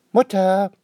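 high, loud call across the yard. A narrator's reading. Girl coming home calls out for her mother, loud call. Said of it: "ruft Anne-Rose".

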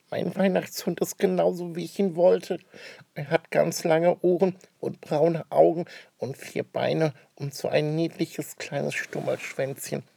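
put-on high, slow child rapture; blissful child. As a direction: gush over the puppy. Kind schwärmt vom Hund. (Schnüpperle schwärmt vom Welpen)

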